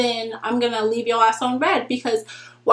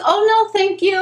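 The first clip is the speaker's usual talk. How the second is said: much higher, louder polite "no, thank you"; sweet polite refusal; 9 semitones higher, 5 dB louder than usual. Retold or imitated. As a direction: decline him sweetly, pitched way up. Politely turning him down.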